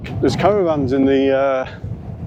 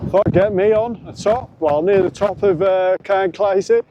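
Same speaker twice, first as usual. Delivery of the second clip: shouting over wind; 6 semitones higher than usual. Real, not acted. Shouting on a windy summit.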